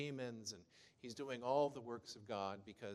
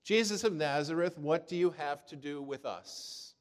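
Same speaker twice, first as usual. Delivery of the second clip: loud, raised cry of a possessed man; 4 semitones higher, 10 dB louder than usual. Retold or imitated. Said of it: "he cries out".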